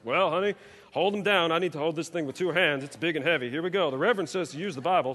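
lightly voiced, fast comic bit of a parent; hammed-up parent grabbing the big Bible; mock parent scene. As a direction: joke it up, barely in character. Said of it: "I'm laughing because".

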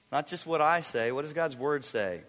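ordinary talk

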